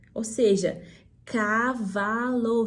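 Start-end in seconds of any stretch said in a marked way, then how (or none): 1.2-2.7 CAVALO bem esticado, ca-va-lo, muito lento; sílaba por sílaba. Shown as a demonstration. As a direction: pronounce cavalo in slow, separated syllables like a pushy teacher. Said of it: "um ortopedista da pronúncia". (cavalo silabado bem devagar)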